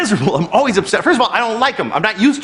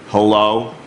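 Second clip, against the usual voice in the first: bored hello; 9 semitones lower than usual. Retold or imitated. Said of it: "This is how she answered the phone".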